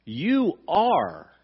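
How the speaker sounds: "You are" with both YOU and ARE punched high and loud; emphatic "You are", word by word; emphatic stress. Shown as a demonstration. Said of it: "is in the emphatic tense".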